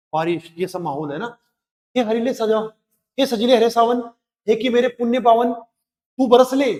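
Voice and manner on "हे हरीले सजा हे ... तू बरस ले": rising, invoking recital with a stretched close; lofty poetic address; poem recitation. Acted. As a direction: call out to the monsoon in verse.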